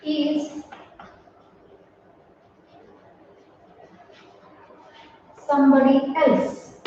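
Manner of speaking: slow, word by word, like dictating to a class; dictation pace. Shown as a demonstration. Teacher dictating an example sentence, slow. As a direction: spell it out slowly for the students to copy.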